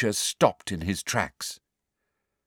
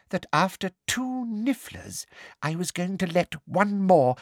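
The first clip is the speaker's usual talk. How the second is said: higher, sugary menace; menacing Umbridge; 8 semitones higher than usual. A narrator's reading. Umbridge menaces Harry held by the hair, sweet menace. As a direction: purr the threat sweetly.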